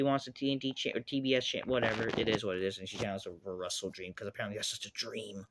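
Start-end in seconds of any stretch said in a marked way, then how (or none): none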